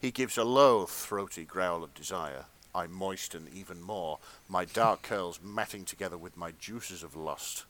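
measured, hushed-down storyteller read; dramatic fan fiction narration. A narrator's reading. Reader performing steamy fan fiction for friends, dramatic narration. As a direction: read it as earnest literary erotica.

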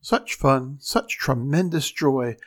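ordinary delivery, nothing marked

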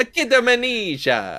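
high-pitched, hammed-up Italian accent; mock Italian accent. Cohost echoes the corrected title, mock Italian accent. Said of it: "it's the Italian version".

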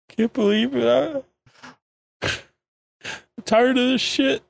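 high, slow pretend sobbing; mock crying. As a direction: drag it out in a put-on weepy whine. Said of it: "Takuma's crying".

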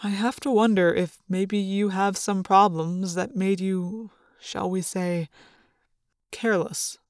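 quietly suspicious school official; Chapman. A narrator's reading. Narrator voices Chapman's accusation.

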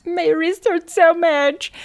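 high hammed-up whine; mock tearful complaint in a put-on voice; pathetic whining. Host imitating the handcuffed suspect, pathetic high whining. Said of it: "really pathetic about it".

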